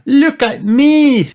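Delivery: loud, high, drawn-out show-off call; Peppa. A narrator's reading. Peppa showing off a new costume, loud high voice. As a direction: call for attention in a high child voice. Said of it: "said Peppa".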